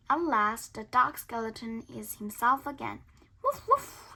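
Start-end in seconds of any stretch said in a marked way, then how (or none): 3.4-4.1 high woof woof; high dog bark. Acted. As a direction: bark happily. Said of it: "he barks".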